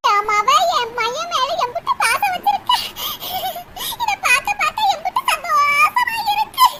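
hammed-up happy tears over brother's love; mock laughing through tears. Daughter laugh-cries at brother's love. (அண்ணனின் பாசத்தில் சிரிப்பும் அழுகையும் கலந்த மிகைக் குரல்)

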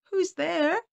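high, loud call; high questioning hail. A narrator's reading. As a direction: call out to someone below. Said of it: "she called".

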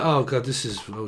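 ordinary talk

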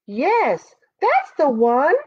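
a hint of a high-pitched badger; badger character. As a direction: answer as a small, insistent animal.

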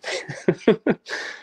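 high airy giggle, no voice in it; breathy giggle. Real, not acted. Laughing with his wife after teasing her, airy giggle. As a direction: let a light laugh slip out.

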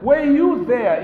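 God challenging. Acted. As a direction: throw the question at Job. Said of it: "God is telling Job that".

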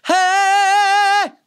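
long, loud, high "hey"; breath-supported high note. Shown as a demonstration. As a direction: belt a held high shout on a full breath. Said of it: "You just take the breath".

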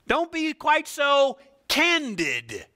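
high, drawn-out cautioning; mock pleading. Believers urging Jesus to tone down, high mock plea. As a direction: plead slowly in a high put-on voice.

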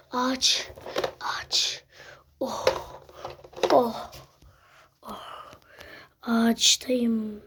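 hushed whisper while hiding. Acted. Hiding in a tree from the monster, a low whisper. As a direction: whisper so the hunter can't hear.

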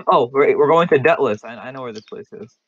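ordinary talk

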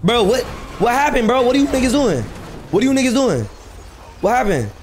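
usual speaking voice

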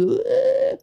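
very high, drawn-out squeal; passerby's squeal. Retold or imitated. Passerby hit by bird droppings, imitated high squeal. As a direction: imitate a startled high-pitched cry. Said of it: "Le passant, il a fait".

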